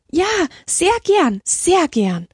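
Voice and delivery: high, delighted acceptance, easing at the end; overjoyed. A narrator's reading. Nuns accepting the old man's offer to build, overjoyed. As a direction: accept eagerly with a burst of joy. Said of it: "außer sich vor Freude".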